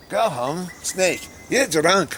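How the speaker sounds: high, put-on Scottish accent; fake Scottish accent. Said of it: "I did that in a fake Scottish accent".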